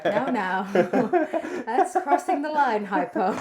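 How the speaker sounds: loud, laughing telling-off; laughing mock scold. Real, not acted. Streamer teasing a chatter for crossing the line, laughing mock scold. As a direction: scold playfully through laughter.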